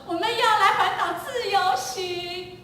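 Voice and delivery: high, slow, sing-song family outing voice; a parent playing it up about the trip; mock cheerful announcement. Parent announcing the road trip to the family, hammed-up cheer.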